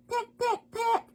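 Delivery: very high, short little pecks; sparrow pecking. Acted. Sparrow pecking at seeds, tiny high voice. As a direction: peep each word like a tiny bird.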